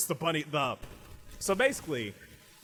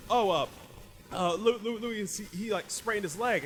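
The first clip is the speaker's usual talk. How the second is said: flustered alarm; 8 semitones higher than usual. Acted. Partner voiced reporting the injury.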